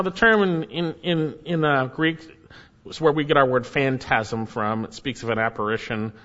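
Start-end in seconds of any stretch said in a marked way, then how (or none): none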